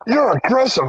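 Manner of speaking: high-pitched imitation of protest; mock complaint. Storyteller as shoplifter to guard, high mock complaint. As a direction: whine it as the wronged thief.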